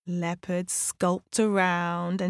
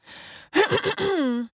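attention-getting throat clear; exaggerated acted ahem; 11 semitones higher, 3 dB louder than usual. A narrator's reading. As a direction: clear your throat for everyone to hear. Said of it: "He cleared his throat".

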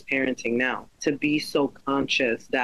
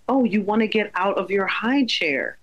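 put-on high, sing-song reply to a toddler; mock mommy voice to a toddler; bright mom voice; 6 semitones higher than usual. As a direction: raise the voice like talking to a little child. Mother voicing her reply to son.

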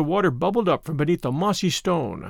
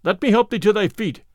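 plain reading voice

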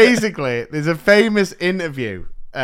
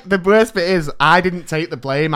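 normal talking voice

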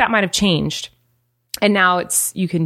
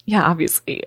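ordinary talk

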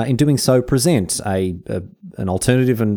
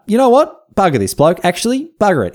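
much higher and louder "bugger this bloke"; mock fed up; 9 semitones higher, 3 dB louder than usual. Host giving up on Darwin after his farewell, louder mock disgust. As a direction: fling it off in hammed-up disgust.

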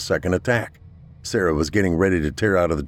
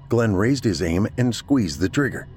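plain reading voice

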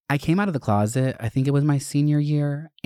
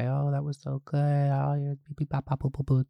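soft, higher mock teacher gush; imitating Miss Lee; 4 semitones higher, 4 dB quieter than usual. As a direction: gush sweetly like a teacher, lightly mocked. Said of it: "she came and was like".

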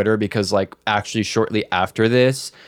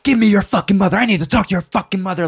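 furious high-pitched yell; angry mom screaming at the scammer kid; 10 semitones higher, 4 dB louder, faster than usual. Retold or imitated. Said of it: "Like, screaming at him".

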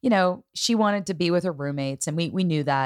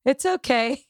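higher, lightly put-on daughter voice saying It's okay; imitating the daughter; 9 semitones higher, slower than usual. Daughter Avery calming her crying mother, high put-on voice. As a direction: play the daughter reassuring mom.

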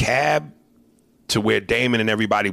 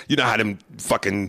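usual speaking voice

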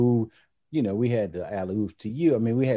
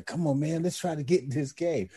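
higher-pitched rallying of teammates, not shouted; retold urging; 5 semitones higher, 3 dB quieter than usual. Player to relaxed teammates in Philly, retold urging. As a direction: lightly urge them on, pitch lifted.

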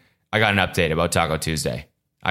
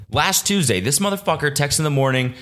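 normal talking voice

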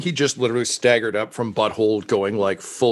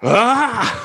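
high, loud cry of fury; high-pitched outburst of rage; rage scream; 13 semitones higher, 5 dB louder than usual. Acted. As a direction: let out a villain's enraged yell. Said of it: "he flies into a rage".

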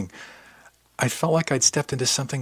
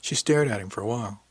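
ordinary delivery, nothing marked

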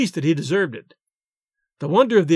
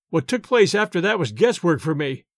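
usual speaking voice

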